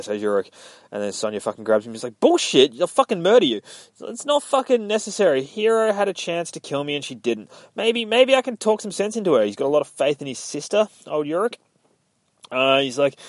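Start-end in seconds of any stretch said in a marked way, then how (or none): 2.2-9.4 higher-pitched, pleading argument; urgent protest. Retold or imitated. Reader voicing a grab and argument to stop a murder, urgent protest. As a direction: argue it in a raised, insistent pitch. Sonya grabs him, argues.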